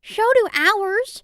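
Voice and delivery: high, loud little sheep; high storybook sheep voice. A narrator's reading. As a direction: pipe up as a sheep. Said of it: "said the sheep".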